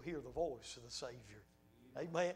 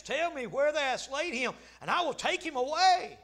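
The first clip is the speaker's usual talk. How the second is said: raised, ringing Bible reading; dramatic scripture reading; 7 semitones higher, 9 dB louder than usual. Acted. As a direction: declaim the verse to the congregation, building. Reads scripture aloud to congregation.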